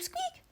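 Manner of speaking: a tiny high squeak; eerie high squeak. Acted. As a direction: squeak like a hinge. Secret door noise.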